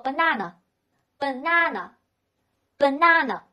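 British "banana", middle a a long "ah"; British accent. Shown as a demonstration. Teacher repeats the word for learners, British accent. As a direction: say "banana" slowly, British style. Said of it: "在英式发音当中呢".

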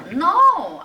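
loud sharp No!; emphatic no. Shown as a demonstration. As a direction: snap out a firm no. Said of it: "no라고 나왔죠".

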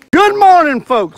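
loud, high-pitched morning greeting; hearty greeting. Real, not acted. Fisherman greets viewers on Lake Murray, hearty call.

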